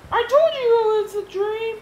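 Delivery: high, loud squeaky character voice; George. Acted. George telling his friend it was a dream, high and loud. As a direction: pitch it way up for George.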